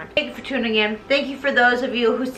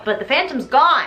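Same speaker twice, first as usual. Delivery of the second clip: usual speaking voice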